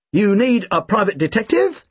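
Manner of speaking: eager brother putting on a business air; Tim's voice. Acted.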